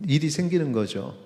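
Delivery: usual speaking voice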